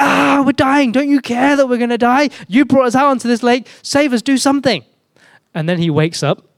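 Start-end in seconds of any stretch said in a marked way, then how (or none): 0.0-4.8 high, panicked wail of pleading; hammed-up desperate cry for rescue; mock freak-out. Disciples begging Jesus in the storm, mock panic. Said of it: "they freak out".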